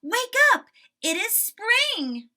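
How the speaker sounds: high loud wake-up call; excited shout. Acted. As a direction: shout the good news. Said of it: "shouted Frog".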